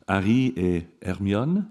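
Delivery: Harry et Hermione in French, no h, rolled French r; slow careful French reading; French pronunciation. Shown as a demonstration. Professor tries the French names for students. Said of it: "is that how you pronounce it".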